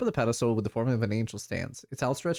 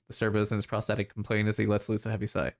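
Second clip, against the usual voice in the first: normal talking voice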